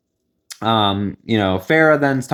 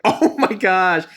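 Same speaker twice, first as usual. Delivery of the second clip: higher, slow, stretched-out oh my gosh; shocked gasp; 5 semitones higher, much slower than usual. Retold or imitated. Fan reacting as Manila is called, stretched shocked gasp. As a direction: let the shock spill out slowly.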